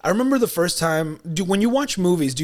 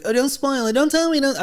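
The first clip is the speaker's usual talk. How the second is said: high-pitched mock pleading; mock spoiler-hater; 11 semitones higher than usual. Mocking the guy who hates movie spoilers, high whiny plea. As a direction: imitate a fussy guy begging, pitch jumping up. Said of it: "I'm not that guy that's like".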